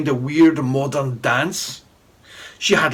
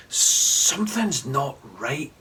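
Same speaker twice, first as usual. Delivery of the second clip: quiet worried Jay; slow worried boy; 4 dB quieter, slower than usual. A narrator's reading. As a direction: let it hang, slow and uneasy. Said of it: "I said".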